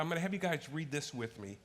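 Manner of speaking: ordinary delivery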